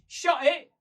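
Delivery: high, loud telling-off; indignant. Acted. Prisoner silencing the man teasing her, indignant. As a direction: cut him off loudly.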